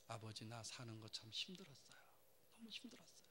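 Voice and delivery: hushed, broken whisper to a portrait; tearful whisper. A narrator's reading. Son whispering to his late father's portrait, tearful. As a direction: whisper it through tears to father.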